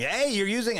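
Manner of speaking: very high, mock yell; retold yell. Customer protesting at a line-cutter, mock yelling. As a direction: yelp it out in protest. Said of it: "then I'm yelling".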